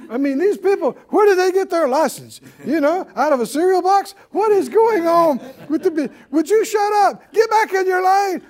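high, loud hammed-up exasperation; mock road rage. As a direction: build from exasperated griping to yelling at other drivers. Acts out a griping driver.

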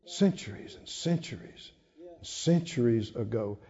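breathy awed whisper of "Centuries and centuries"; whispered awe before the congregation; hushed whisper. Real, not acted. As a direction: drop to a whisper, like sharing a wonder. Awed hush about ancient story.

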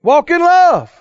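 high, loud rallying cry; emphatic preaching. Real, not acted. Preacher repeats the answer to congregation, loud and emphatic. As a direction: call it out across the congregation.

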